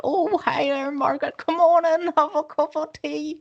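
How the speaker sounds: put-on high sweet old lady voice, pitched well up; little Northern Irish lady. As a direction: chirp it like a tiny old lady greeting a friend. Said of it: "the little Northern Irish ladies and being like".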